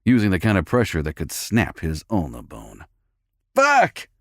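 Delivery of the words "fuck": high pained screech. A narrator's reading. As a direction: screech it out in pain. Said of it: "he screeched".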